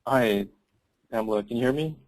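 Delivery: usual speaking voice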